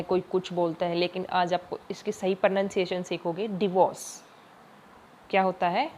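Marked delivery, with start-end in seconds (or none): 3.5-4.2 डिवोर्स with a short di, not डाइवोर्स; correct pronunciation. Shown as a demonstration. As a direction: say डिवोर्स, first vowel short i. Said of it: "कोई इसको डाइवोर्स बोलता है". (डिवोर्स, डाइवोर्स नहीं)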